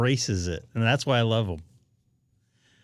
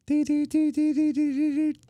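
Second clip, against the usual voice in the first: imitated Morse beeps.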